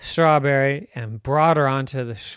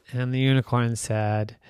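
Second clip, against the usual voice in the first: usual speaking voice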